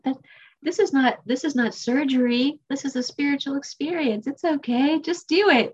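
high, insistent mock coaxing; urging sponsor. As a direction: imitate a sponsor pushing past someone's fear. Sponsor urging her through her fear.

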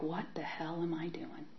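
hushed, horrified whisper to herself; breathless whisper of dismay; whispered self-reproach. Retold or imitated. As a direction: whisper it in shock at yourself. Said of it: "And I was like".

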